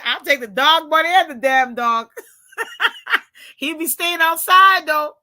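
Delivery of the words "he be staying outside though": ordinary talk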